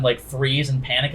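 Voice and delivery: usual speaking voice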